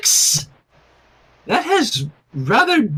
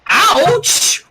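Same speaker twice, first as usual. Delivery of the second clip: high, louder yelp of pain; cringing ouch; 11 semitones higher, 4 dB louder than usual. A narrator's reading. Rainbow reacting to the card, high cringing yelp. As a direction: wince out a sharp ouch. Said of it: "Rainbow said, cringing".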